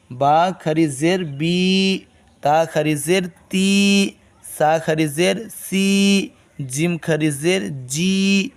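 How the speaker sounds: بی تی سی جی each held long and slow; drawn-out long vowels. Shown as a demonstration. Teacher drills students on long kasra. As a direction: stretch the final vowel of every syllable. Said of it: "تھوڑا کھینچیں گے".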